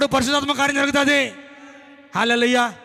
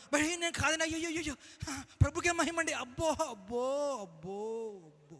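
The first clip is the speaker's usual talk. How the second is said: fake humility; 2 semitones higher, 12 dB quieter than usual. Mocking false-humble believers before God, in hushed fake modesty. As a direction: act humble for show, fading away. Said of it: "పైకి బలి నటిస్తారు".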